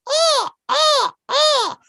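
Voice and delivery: high, loud mock caw; high-pitched bird squawks; crow cawing. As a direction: imitate a crow cawing three times. Imitates crow in courtroom.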